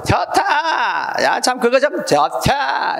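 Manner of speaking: high, slow, drawn-out cry of delight; delighted exclamation. Shown as a demonstration. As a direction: stretch it out like something truly pleases you. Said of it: "이렇게 하는데". (높고 느리게 늘인 감탄)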